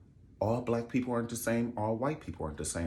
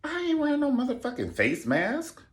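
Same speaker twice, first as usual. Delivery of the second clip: higher, mocking defiant rioter; mock rioter shout. Mocking maskless rioters at the Capitol, higher defiant shout.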